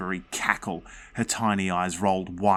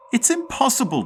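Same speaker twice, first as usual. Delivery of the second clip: declared; 6 dB louder than usual. Acted. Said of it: "Jeff declared".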